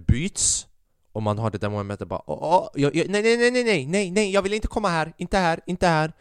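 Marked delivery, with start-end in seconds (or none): 2.3-6.1 high, loud hammed-up panic, no no no; mock-panicked pleading. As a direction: play it like a frantic mock protest. Acted panic when the scene changes.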